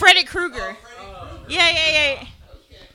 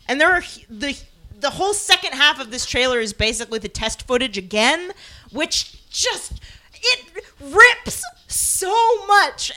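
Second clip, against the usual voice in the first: ordinary delivery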